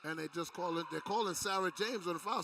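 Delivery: ordinary talk, nothing marked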